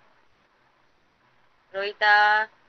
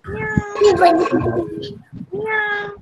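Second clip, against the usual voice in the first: higher-pitched meow, like a cat; cat meow imitation; 7 semitones higher than usual. Shown as a demonstration. Teacher shows children how a cat sounds, meowing. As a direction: mimic a cat for small children. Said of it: "cat is".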